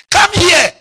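loud, high call. Real, not acted. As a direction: shout it across the church. Preacher calling out.

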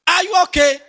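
mock concern. Mock condolence to widow.

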